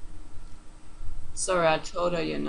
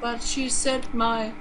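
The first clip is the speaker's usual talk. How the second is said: normal talking voice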